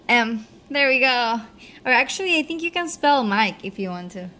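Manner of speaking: normal talking voice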